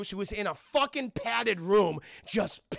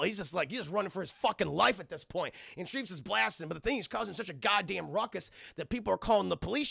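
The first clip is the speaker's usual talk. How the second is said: usual speaking voice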